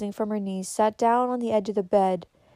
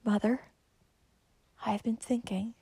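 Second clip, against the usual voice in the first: soft, weak half-whisper; 8 dB quieter than usual. A narrator's reading. Dying girl wakes to confide in Mother, whispered. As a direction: murmur it very softly, like an invalid. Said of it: "suddenly opened her eyes and whispered".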